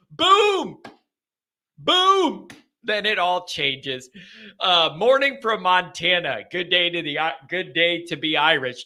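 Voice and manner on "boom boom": two high, punchy bursts; sudden booms. Retold or imitated. Host showing calm upended by Notre Dame news, sudden high booms. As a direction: pop each one out high, with a pause between. Sudden upset after feeling comfortable.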